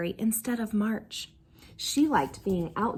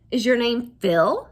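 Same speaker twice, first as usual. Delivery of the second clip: gruff uncle; 6 dB louder than usual. A narrator's reading. Uncle challenges.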